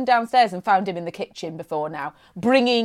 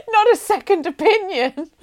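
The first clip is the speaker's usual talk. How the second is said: laughing; 9 semitones higher than usual. Real, not acted. Laughing at the story.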